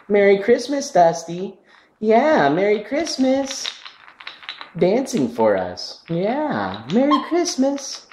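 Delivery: loud, slow, delighted Christmas cheer; excited cheering. Real, not acted. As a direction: celebrate the dancing bird loudly. Cheering bird while it dances.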